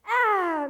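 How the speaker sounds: high imitated baby squall, a little weaker; one final protesting baby yell; baby's last scream. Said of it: "The baby goes".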